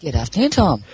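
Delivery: a lightly put-on Irish accent; mock Irish accent. Mock Irish greeting to the host. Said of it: "Still Irish".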